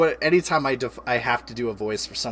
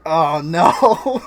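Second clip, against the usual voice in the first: mock dismay; 5 semitones higher, 8 dB louder than usual. Dismay at wasted work.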